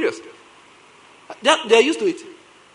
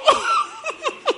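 high laugh; 3 dB quieter than usual. Real, not acted. Laughing at the story.

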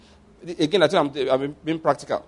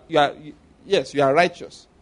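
ordinary talk, nothing marked

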